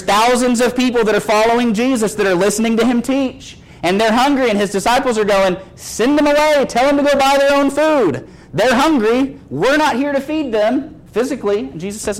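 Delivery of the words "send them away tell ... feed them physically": high-pitched, insistent acted complaint; exasperated protest. Disciples urging Jesus to send the hungry away, exasperated.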